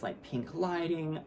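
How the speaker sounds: normal talking voice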